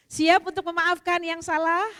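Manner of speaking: loud, rising question to the crowd; preacher rousing the women to forgive; rallying call. Real, not acted. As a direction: call out to the room for an answer. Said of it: "asks audience to forgive".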